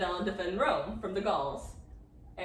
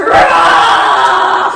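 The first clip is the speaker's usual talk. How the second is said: monster roar; 8 semitones lower, 23 dB louder than usual. Acted. Furies roar.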